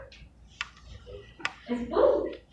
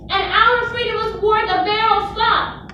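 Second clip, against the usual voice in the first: high, loud honk of a goose; 10 semitones higher, 7 dB louder than usual. A narrator's reading. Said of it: "honked the goose".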